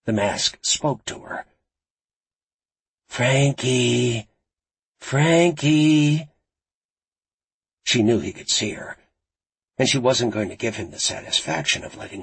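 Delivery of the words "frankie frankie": drawn-out taunting call, rising in intensity. A narrator's reading. Masked villain calls to Frankie on screen, taunting. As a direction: call the name out long and teasingly.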